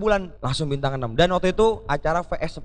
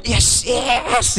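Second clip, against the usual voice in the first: excited yes; 7 semitones higher than usual. Retold or imitated. Excited caller answering phone.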